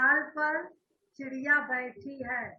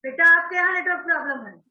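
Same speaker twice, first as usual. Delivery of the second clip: loud; 4 semitones higher, 9 dB louder, faster than usual. Real, not acted. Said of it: "चिल्लाइए मत".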